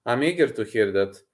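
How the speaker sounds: ordinary talk, nothing marked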